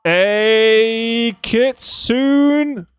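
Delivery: very slow, high, loud stretched name; drawn-out excited call. Real, not acted. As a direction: sing out the name with delight. Greeting a viewer by name.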